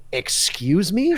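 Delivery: slow, high, drawn-out "excuse me?"; floored, asking him to repeat it; stunned disbelief. Retold or imitated. Hearing he presents the conference, slow shocked reply.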